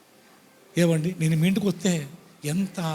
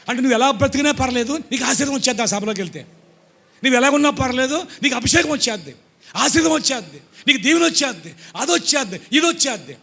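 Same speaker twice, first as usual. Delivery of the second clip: mocking preacher's promises; 6 semitones higher, 4 dB louder than usual.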